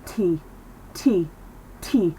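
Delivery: "T" with a strong puff of air; airy T sound. Shown as a demonstration. Teacher shows air felt on the hand, aspirated T. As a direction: blow air through the teeth on each "T". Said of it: "I can feel the air on my hand".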